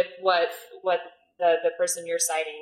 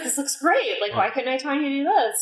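a bright, higher, louder gush of admiration; mock-thrilled inner voice; impressed gush; 8 semitones higher, 3 dB louder than usual. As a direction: play back your own wowed reaction. Retelling her excited thought watching.

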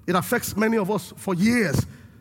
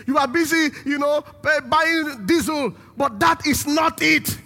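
raised, louder preaching voice; impassioned preaching; 4 semitones higher, 3 dB louder than usual. Real, not acted. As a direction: press the point home forcefully from the pulpit.

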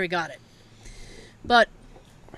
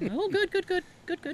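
imitating dad; 8 semitones higher, 6 dB quieter than usual. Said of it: "as my dad calls it".

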